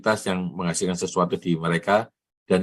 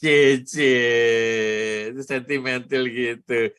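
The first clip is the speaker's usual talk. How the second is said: teasing cheer; 4 semitones higher, much slower than usual. Real, not acted. Teasing a colleague over praise.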